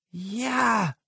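high, drawn-out cry of triumph; excited shout. A narrator's reading. Tony rushing to the puddle of lotion, shouting excitedly. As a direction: let it soar high and long. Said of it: "rief Tony".

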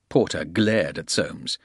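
plain reading voice

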